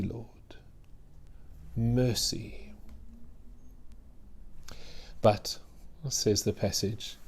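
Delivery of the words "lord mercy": breathy whispered plea for mercy. Acted. Preacher voicing the mother's last plea, whispered. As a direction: let the plea fall to a whisper.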